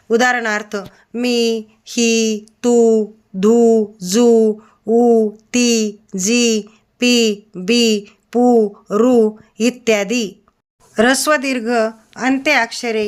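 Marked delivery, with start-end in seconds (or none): 2.6-10.4 तू, धू, जू, ऊ, ती, जी, पी, बी, पू, रू said very slowly and louder, each final ई or ऊ stretched long; long vowels drawn out. Shown as a demonstration. Teacher showing pupils how the long vowel in पी, बी, पू, रू is stretched, slow and louder. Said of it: "दीर्घ उच्चारला जातो".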